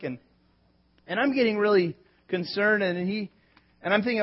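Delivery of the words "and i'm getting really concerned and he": usual speaking voice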